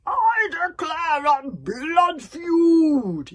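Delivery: high, slow bellowed roar; furious yell. Acted. Stoic declares a blood feud on the bog burglars, roaring. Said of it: "yelled Stoic the Boss".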